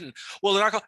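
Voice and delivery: normal talking voice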